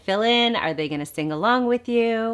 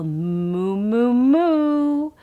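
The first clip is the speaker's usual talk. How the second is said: higher, louder sing-song moo moo; playful moo offered to a baby; babbled moo; 4 semitones higher, 5 dB louder, much slower than usual. Shown as a demonstration. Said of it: "offer it up as a babble".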